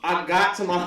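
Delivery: ordinary talk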